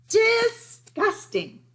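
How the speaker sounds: high, loud, drawn-out disgust; disgusted Lily. A narrator's reading. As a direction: spit the word out high and slow like a bratty big sister. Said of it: "said Lily".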